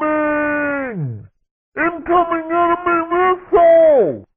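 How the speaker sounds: high-pitched cartoon alarm cry; alarmed warning. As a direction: call out incoming danger, high and urgent. Warns of an enemy missile.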